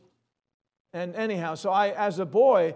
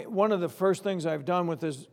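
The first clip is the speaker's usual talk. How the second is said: ordinary talk, nothing marked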